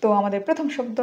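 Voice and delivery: ordinary talk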